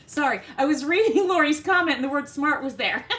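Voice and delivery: laughing. Real, not acted. Laughing at her own slip.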